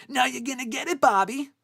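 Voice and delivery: hammed-up Happy Gilmore taunt; imitating Happy Gilmore. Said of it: "I love when Happy goes".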